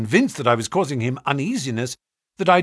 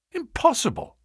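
high shocked outburst; shocked cry; 13 semitones higher than usual. A narrator's reading. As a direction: cry it out in disbelief. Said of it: "I cried".

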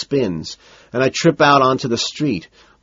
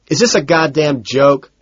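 raised, indignant question; indignant outburst; 4 semitones higher, 4 dB louder than usual. A narrator's reading. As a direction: protest in appalled irritation. Appalled outburst at the deli.

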